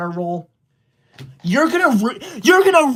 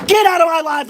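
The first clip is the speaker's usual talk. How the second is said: mock angry; 7 semitones higher, 4 dB louder than usual. Mock-angry streamer banishing a viewer.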